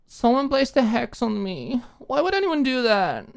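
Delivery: put-on high, slow, whiny drunk voice; tipsy girl whining about her legs; drunk pouting whine. A narrator's reading. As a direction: pout and whine like a cursed victim. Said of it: "She pouted".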